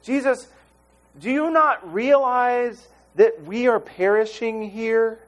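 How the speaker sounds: urgent desperate appeal, hammed up a little; mock pleading. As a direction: plead like a frightened disciple. Disciples pleading in the storm.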